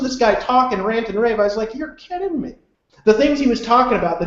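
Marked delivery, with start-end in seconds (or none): 1.8-2.6 high-pitched, softer incredulous reaction; retold disbelief. Listener amazed by the rich man's stories, incredulous. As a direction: marvel in a high, lighter voice.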